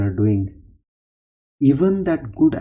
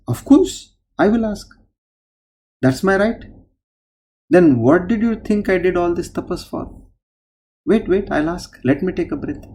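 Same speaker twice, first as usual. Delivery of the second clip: higher, pushy voice claiming his due; demanding sadhu; 6 semitones higher than usual. Acted. Sadhu demanding his reward from the Lord, pushy and raised. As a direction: insist on what is owed, pitched up. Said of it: "he says".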